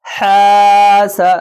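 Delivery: very long "हा", quick short "सा" as sa; long then short. Shown as a demonstration. Teacher showing madda then zabar, long then short. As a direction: stretch only "हा" and cut "सा" short. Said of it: "ज़बर को जल्दी पढ़ना है".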